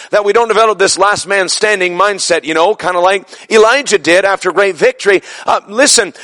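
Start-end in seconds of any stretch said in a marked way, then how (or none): none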